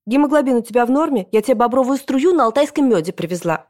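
high, busy grandmother voice; excited fussing grandmother. A narrator's reading. Grandmother appraising the pregnant mother's belly, excited and fussing.

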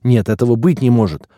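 alarmed, thin protest; thin-voiced yell. A narrator's reading. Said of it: "орал тонким голосом полный".

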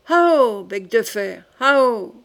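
loud, drawn-out hallooing cry; falconer's call. Acted. Charles urging his falcon Bec de fer into the fight, hallooing. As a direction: call out to spur on the bird.

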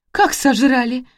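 high-pitched, aghast question; startled disbelief; shocked gasp. A narrator's reading. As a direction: ask it aghast, pitch jumping up. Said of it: "ахнула Ирина Леонидовна".

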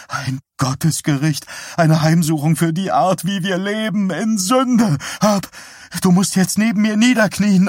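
high, loud, frantic pleading; panicked religious wife crying out. Acted.

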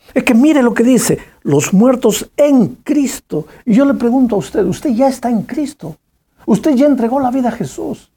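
preacher urging listeners to give their life to Jesus; fervent altar call. Acted. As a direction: press each question on the congregation.

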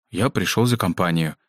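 usual speaking voice

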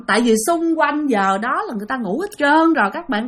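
ordinary talk

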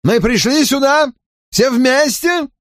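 sneering unpleasant tone. A narrator's reading. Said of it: "вещал он весьма неприятным голосом".

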